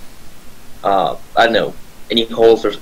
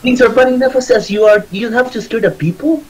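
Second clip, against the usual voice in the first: much higher-pitched, put-on scolding voice; scolding character voice; 12 semitones higher than usual. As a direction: tell off foolish companions in a high voice. Character scolds companions after whacking them.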